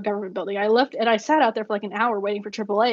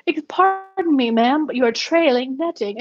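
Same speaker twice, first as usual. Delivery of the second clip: slow, much higher, hammed-up posh British accent; exaggerated British accent; 4 semitones higher than usual. Driver mimics the Englishman, exaggerated British accent. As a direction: lay the English accent on as thick as you can. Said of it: "the most English British that you can muster".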